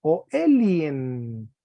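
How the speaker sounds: "Elien" very slow, the final N stretched; teacher highlights the euphonic N; drawn out. Shown as a demonstration. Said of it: "puede ser Elie".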